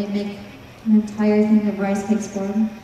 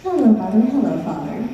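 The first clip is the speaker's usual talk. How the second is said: put-on polite little boy. A narrator's reading. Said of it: "And with perfect manners".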